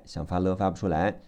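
ordinary talk

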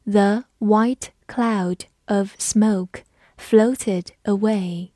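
slow, careful model reading; teacher reading slowly for the class. Shown as a demonstration. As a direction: stretch each word out slowly. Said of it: "我们慢读一遍".

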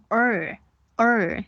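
"儿" with the tongue curled hard into an American er; long, heavily curled "儿"; exaggerated retroflex. Shown as a demonstration. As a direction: overdo the r colour on "儿". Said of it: "卷得很夸张".

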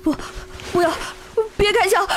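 panicked stammer rising to cries; begging for his life; terrified begging. Acted. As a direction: candidate begs examiner not to shoot, terrified. Gun pointed at him.

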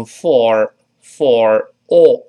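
slowly, full "for" with an r; slow full vowel. Shown as a demonstration. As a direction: stretch out "for" unreduced. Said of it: "这个介词读慢了呢". (慢读for，加卷舌)

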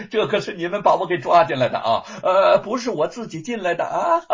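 fast, loud, laughing words; talking through laughter. Acted. Young man laughing at the guards who dragged him in, laughing speech. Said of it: "禁不住的大笑着".

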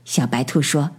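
ordinary delivery

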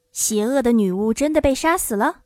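usual speaking voice

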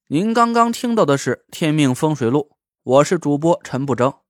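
热情的结尾口播; 响亮地播报节目结尾; 主播口播. Acted. 主播结尾口播. (电台主播腔口播)

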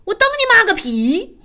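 high, sharp cursing snap at the radio; hammed-up cabbie swearing at a love song; mock angry curse. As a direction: spit the curse back like a grumpy cabbie.

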